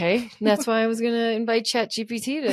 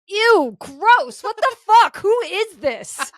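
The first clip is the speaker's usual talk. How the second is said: high, loud, laughing "ew, gross, what the fuck"; disgusted shriek; 10 semitones higher, 4 dB louder, much slower than usual. Retold or imitated. Texting a stranger back, a high laughing shriek of disgust. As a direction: shriek it in mock disgust at the photo. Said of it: "And my response was".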